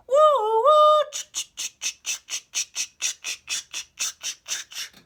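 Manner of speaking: high loud whistle woo, then unvoiced chugging ch; steam train imitation. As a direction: toot like a steam train, then chug along. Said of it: "she heard a train".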